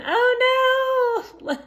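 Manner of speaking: a sudden mock gasp of alarm; high "oh no". Real, not acted. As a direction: squeal "oh no" way up high. Reacting to bee sting.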